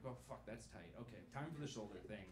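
low, hushed strained mutter; quiet inner grumble; muttered to self. Retold or imitated. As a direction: grumble it under your breath while squeezing in. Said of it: "I was like".

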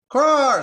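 very high, very loud jay squawk; squawked caw. Acted. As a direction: screech it to cut off the talk. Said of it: "squawked the jay".

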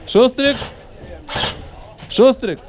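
high call across distance. Real, not acted. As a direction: call to a teammate far away. Calling a teammate by name.

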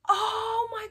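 high, loud cry of disbelief; shocked disbelief. Real, not acted. Disbelief at an Anya costume.

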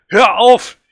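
high, loud pleading shriek; a child screaming at his father to stop strangling her; desperate scream. A narrator's reading. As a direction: scream at him to stop, begging. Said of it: "Ich schreie".

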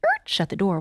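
ordinary delivery

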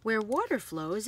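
usual speaking voice

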